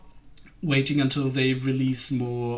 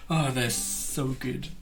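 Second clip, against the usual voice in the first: ordinary delivery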